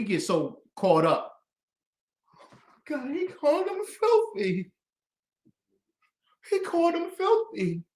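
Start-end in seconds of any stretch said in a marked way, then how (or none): 2.9-7.8 mock outrage. Mocking the critics who object to "filthy", high whiny voice.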